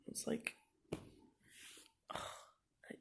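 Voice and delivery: slow, breathy sigh, words failing; sighing breakdown. Real, not acted. Overwhelmed student whose voice gives out, sighing. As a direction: let the voice give out into a sigh. Sighs, voice fails.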